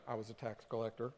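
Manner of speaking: a hint of a shamed Matthew; Matthew answering. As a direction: mumble it like an embarrassed ex-tax man. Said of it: "What were you doing?".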